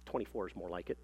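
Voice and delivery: low, soft undertone; dry aside. A narrator's reading. Mother confides between her grand claims, dry low aside. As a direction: drop it in as a private comment.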